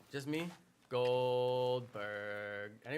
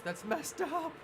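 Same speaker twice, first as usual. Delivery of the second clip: mock outrage; 8 semitones higher than usual. Real, not acted. Reacting to own mock advice.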